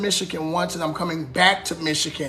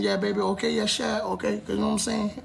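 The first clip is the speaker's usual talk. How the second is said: soft, trial New Orleans accent practice; 8 dB quieter than usual. Shown as a demonstration. Practicing a New Orleans accent for viewers. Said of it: "practicing my New Orleans accent".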